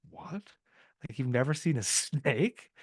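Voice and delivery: high-pitched, laughing disbelief; retold surprise. Retelling her reply to the old man, laughing surprise. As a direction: act out being amazed, voice jumping up. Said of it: "I was really surprised by it".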